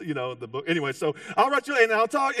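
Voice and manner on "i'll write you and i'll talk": loud, high mock farewell; groom calling back. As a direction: ham up a departing lover's promise. Groom's promise acted out again.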